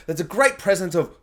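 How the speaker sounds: usual speaking voice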